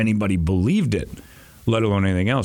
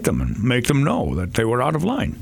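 much higher Scottish brogue; Sean Connery impression; 8 semitones higher than usual. Quoting the Barbara Walters interview, Scottish Connery voice. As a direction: lay on the thick Connery accent. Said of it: "I always kinda did that as the impression".

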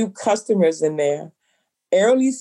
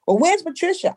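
higher-pitched imitation of a flustered customer; 11 semitones higher, faster than usual. Owner imitating the stunned customer asking for the old owner, high. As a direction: lightly voice the thrown customer, pitch up.

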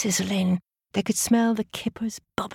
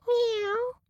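high meowing cat. Acted. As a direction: meow like a cat. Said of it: "meowed through the letterbox".

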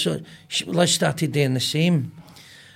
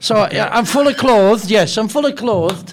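louder, jokey insistence; loud joking protest; 4 semitones higher, 7 dB louder than usual. Pretend. Guest joking to the blindfolded wrestler, louder and playful. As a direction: announce it loudly for laughs.

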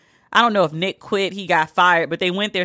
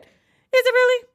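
much higher, mock-incredulous; sarcastic disbelief. Real, not acted. Answering Terry's gushing, high sarcastic disbelief. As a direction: scoff at the claim.

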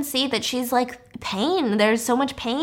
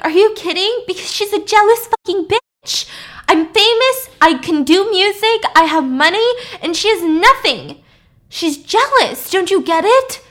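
high, loud, furious outburst; indignant rant; 9 semitones higher, 6 dB louder than usual. Retold or imitated. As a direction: act out an exasperated, wounded tirade. Acts out Lizzie's jealous-rival rant.

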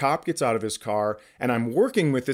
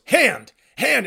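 high, loud barked order; urgent police order; sharp cop command; 10 semitones higher, 5 dB louder than usual. Retold or imitated. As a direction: command him to show his hands. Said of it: "the cop goes".

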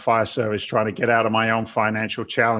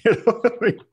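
higher, laughing through the words; 10 semitones higher, faster than usual. Real, not acted. Guest joking about spending more than you earn, laughing. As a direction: chuckle it out after your own joke.

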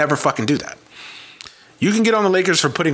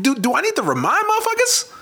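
ordinary talk

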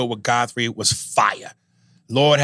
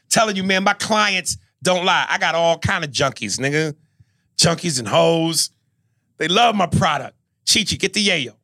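drug dealer voice; 6 semitones higher than usual. Acted. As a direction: brag like a street dealer.

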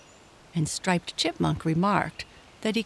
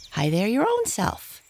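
higher-pitched little chipmunk retort; cheeky chipmunk; 6 semitones higher than usual. A narrator's reading. Striped Chipmunk returning his cousin's hail, cheeky high voice. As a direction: toss the greeting straight back, pitched up. Chipmunk answers a shouted greeting.

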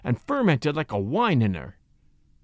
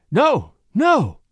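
high, loud frantic refusal; acted panicky outcry; panicked protest; 7 semitones higher, 5 dB louder than usual. A narrator's reading. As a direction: protest desperately, pitch jumping up. Panicked wife refuses to go outside.

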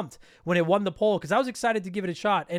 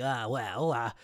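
stuffy mumble; 9 semitones lower, 5 dB quieter, much slower than usual. Shown as a demonstration. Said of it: "if I sound extra annoying and stuffy and like".

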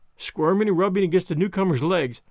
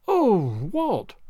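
drawn-out, higher appeal; instinctive cry; 3 semitones higher than usual. A narrator's reading. Wife appealing to her husband, a dismayed cry. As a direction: cry out mildly for help. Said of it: "Madge's instinctive cry to her husband".

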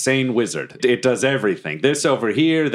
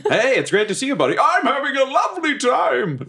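overexcited host. Pretend. Over-the-top cheerful host.

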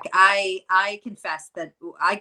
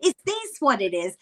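put-on child voice; 8 semitones higher than usual. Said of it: "she was like".

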